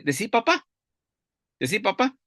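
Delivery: put-on high, sing-song coaxing of a toddler; coaxing parent. Parent teaching a toddler to talk, high coaxing voice. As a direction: coax the baby to say papa.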